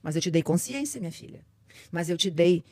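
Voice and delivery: God gently answering his daughter; God speaking. Pretend. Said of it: "vamos simular Deus falando comigo".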